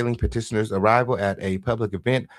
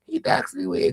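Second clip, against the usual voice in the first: lightly voiced whining accuser; mocking whiny voice; 2 semitones higher, 4 dB quieter than usual. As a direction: mimic him tattling, whiny. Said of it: "He would have been telling everybody".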